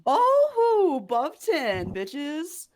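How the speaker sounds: high, loud whoop of victory; triumphant. Real, not acted. Player celebrating a dice roll to the table, triumphant. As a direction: crow over a lucky roll.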